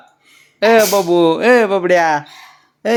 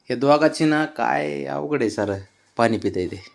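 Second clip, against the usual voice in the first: usual speaking voice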